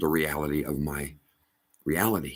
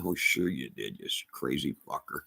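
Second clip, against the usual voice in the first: low, softer dismissive aside; imitated scornful disbelief; 5 semitones lower, 8 dB quieter than usual. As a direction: mimic skeptics dismissing you under their breath. Voices disbelieving listeners.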